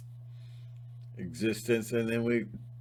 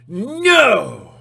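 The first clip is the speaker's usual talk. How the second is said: loud, much higher furious refusal; angry shout; 11 semitones higher, 14 dB louder than usual. Retold or imitated. Timmy refusing Rocky the money, angry shout. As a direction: throw it at the bully with everything. Timmy finally defies the bully.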